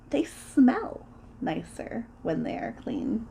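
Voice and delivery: "smell" stretched out and lifted, slow line; slow line leaning on "smell"; stress on smell. Acted. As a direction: linger on "smell" as the discovery. Second discovery, smell is stressed.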